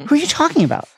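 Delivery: high, puzzled voice; lightly acted puzzlement, pitched high; puzzled question. A narrator's reading. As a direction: ask it high, mildly baffled. Lovesick Ron baffled, higher voice.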